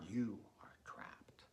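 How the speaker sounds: slow, sinister whispered threat; menacing whisper. Acted. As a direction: breathe the threat slowly in her ear. Villain's threat ends in whisper.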